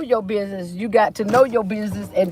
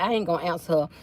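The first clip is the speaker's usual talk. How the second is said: low, soft, lightly voiced God brushing her off; a hint of God dodging the call; God ignoring her; 6 semitones lower, 8 dB quieter, faster than usual. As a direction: mutter it aside like God pretending not to hear. God voiced in retold prayer joke.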